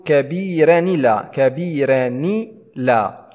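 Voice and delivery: "كبيرا لا" slowly joined as kabiiranilaa; naql. Shown as a demonstration. Teacher sounding the extra nun, "كبيرا لا" as kabiiranilaa. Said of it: "يعني نقول".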